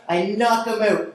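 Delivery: ordinary delivery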